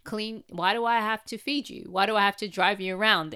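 put-on play-whine back at the child, jokingly. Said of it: "I'll just joke around".

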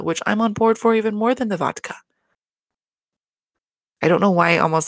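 ordinary delivery

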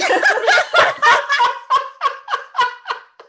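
long high laughter; real laugh. Real, not acted. Listener cracking up at the deathbed story, a long real laugh.

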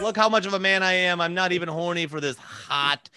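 hammed-up show of self-proud virtue; mock boasting. Mocking Gandhi's boast about willpower.

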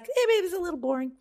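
high, quick, dismissive quote; airy high-pitched brush-off; mocking bored voice. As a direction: toss it off like it bores you. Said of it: "And you were like".

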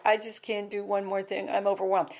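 imitating a tired rehab patient; weary, overwhelmed patient. Rehab patients starting the group, weary and overwhelmed. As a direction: sigh it out like someone with a long face. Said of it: "they'd have the long faces like".

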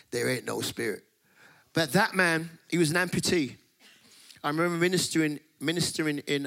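usual speaking voice

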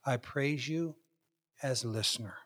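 quiet, near-whispered prayer; hushed breath prayer. Shown as a demonstration. Pastor demonstrating meditative prayer, very soft. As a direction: let it fall away to a hush. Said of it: "try to slow down my heartbeat".

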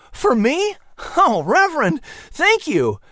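high, delighted exclamation; a boy thrilled by an unexpected gift; bright high thanks, bursting with joy; exclaimed happily. Acted. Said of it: "exclaimed happily".

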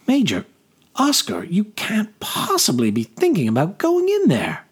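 high-pitched, dismayed disbelief; alarmed noblewoman. Acted. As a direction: plead in alarm, voice pitched well up. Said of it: "She stopped suddenly, hand flying to her mouth".

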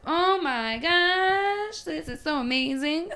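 high, slow starstruck gush; gushing awe. Retold or imitated. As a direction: swoon over a masterpiece. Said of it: "I was like".

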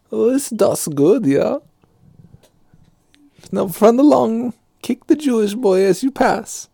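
high, chirpy salesman voice for the evil toy maker; sweet-talking Nazi candy man, high and bright; mock Nazi chocolatier.

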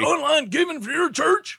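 high barking drill instructor; drill sergeant. Acted. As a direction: bark orders at a recruit. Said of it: "I'll be the drill sergeant".

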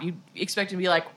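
normal talking voice

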